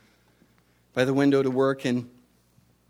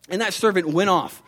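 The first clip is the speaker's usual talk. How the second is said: plain reading voice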